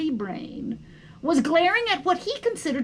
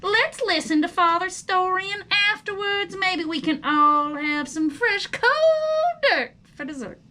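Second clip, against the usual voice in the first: higher, louder cheerful mother worm; enthusiastic mother; 5 semitones higher, 6 dB louder than usual. Acted. Mother cheers up family at dinner, enthusiastic. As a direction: chirp it out brightly to rally the family.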